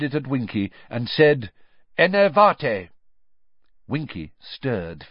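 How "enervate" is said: firm, raised spell casting; spell incantation. A narrator's reading. Wizard casting a spell on Winky, firm incantation. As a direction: call out the magic word with force.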